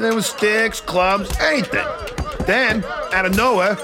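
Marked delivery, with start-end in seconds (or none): none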